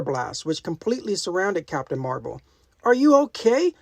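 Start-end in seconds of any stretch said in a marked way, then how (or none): 2.8-3.7 raised, high calling voice. A narrator's reading. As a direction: call out to a friend. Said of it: "Gamora called out to her ally".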